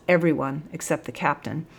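ordinary delivery

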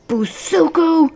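high, loud, drawn-out cry. A narrator's reading. Sergeant finding the cadet in the next cell, crying out. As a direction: wail the name in alarm. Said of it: "the sergeant cried".

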